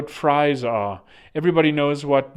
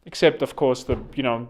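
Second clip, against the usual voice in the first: ordinary delivery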